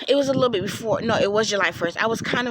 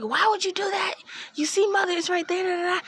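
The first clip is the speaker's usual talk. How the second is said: high scolding; 11 semitones higher than usual. Retold or imitated. Scolding sister for hinting.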